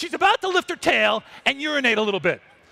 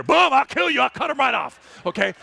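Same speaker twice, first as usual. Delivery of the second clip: ordinary delivery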